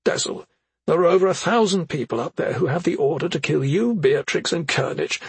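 a hint of an anxious mother; mother's voice. A narrator's reading. Effie warns.

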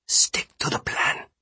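slow, firm whisper; hushed, measured insistence; whispered order. A narrator's reading. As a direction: whisper it as a stern command. Jundo's hushed order before attack.

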